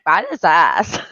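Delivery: lightly voiced valley girl question, rising at the end; a hint of a puzzled valley girl. Mother imitating her toddler son like a valley girl. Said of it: "He sounded like a valley girl".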